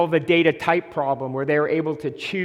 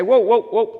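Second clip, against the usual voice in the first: raised urgent calling out; urgent whoa; 5 semitones higher, 6 dB louder than usual. Retold or imitated. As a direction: halt them with a quick whoa. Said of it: "You cannot just say".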